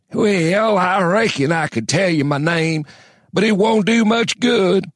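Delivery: put-on high, cracked old codger; gravelly old man voice. A narrator's reading. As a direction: rasp it like an ancient prospector. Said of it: "said the old slow man".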